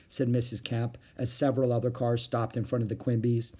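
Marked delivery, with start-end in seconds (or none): none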